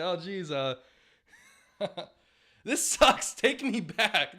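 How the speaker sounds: put-on high, laughing voice of a just-woken old ballplayer; hammed-up old man pulled from heaven; groggy Ted Williams. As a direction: whine through giggles about the dream. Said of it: "And he's like".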